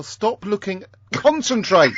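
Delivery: high, loud reprimand; scolding order. Real, not acted. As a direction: order a wandering listener back to attention. Scolds a distracted co-host.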